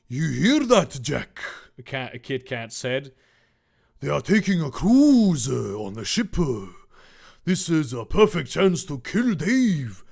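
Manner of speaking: loud scheming henchman voice; villain cat voice. Acted. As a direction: plot the murder with glee. Kit Kat villain voice.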